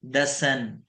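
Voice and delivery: ordinary talk, nothing marked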